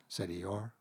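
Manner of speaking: ordinary delivery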